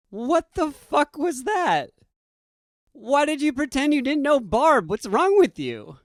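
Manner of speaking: high, loud and disbelieving, slowed on the first line; incredulous outburst. Retold or imitated. Kyle confronting his friend after leaving the coffee shop, incredulous. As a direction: demand an answer in total disbelief.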